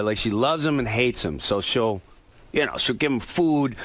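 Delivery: normal talking voice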